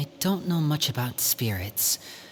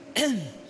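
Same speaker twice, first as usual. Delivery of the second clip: awkward throat clear; 7 semitones higher than usual. Acted. Awkward pause before covering.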